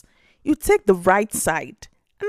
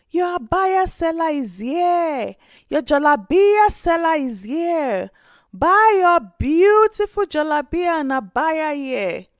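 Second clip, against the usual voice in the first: loud, higher sing-song hawker's cry; street vendor calling out; 6 semitones higher, 4 dB louder than usual. Acted. Seller Musa calls out his abayas to the village, loud hawker's cry. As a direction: call your wares down the street to the villagers. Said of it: "calling out".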